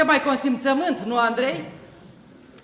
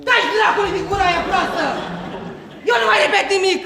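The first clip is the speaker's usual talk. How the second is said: higher and louder, raging; furious yell; 7 semitones higher, 5 dB louder than usual. Acted. Actress exploding at a colleague, yelling furiously. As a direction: lose it completely.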